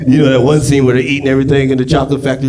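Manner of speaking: usual speaking voice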